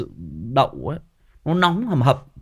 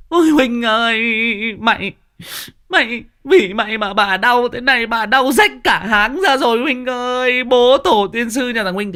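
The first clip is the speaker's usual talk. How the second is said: loud, high wailing tirade; labour-pain cursing; 9 semitones higher, 6 dB louder than usual. Acted. Woman in labour cursing husband Huynh, high loud wail. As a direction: howl curses at the husband, hammed-up. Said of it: "nó chửi chồng".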